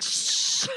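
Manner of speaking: long, sharp "shh"; hushing hiss; urgent shush. Acted. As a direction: shush a friend who is too loud. Shushes the shouting friend while climbing.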